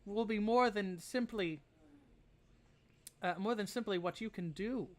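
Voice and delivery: put-on high, grateful but grim old lady; frail old woman. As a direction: pitch up, a wounded old woman. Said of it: "but she'll go".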